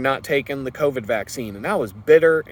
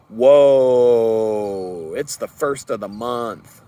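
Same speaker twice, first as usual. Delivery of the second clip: startled whoa; 6 dB louder, much slower than usual. Retold or imitated. Reacting to landlord's angry call.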